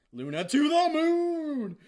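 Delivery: booming declaration. Retold or imitated. Retelling the banishment to viewers, slow grand shout. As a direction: belt it out like an epic movie trailer. Dramatic climax of retold scene.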